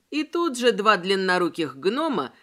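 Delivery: plain reading voice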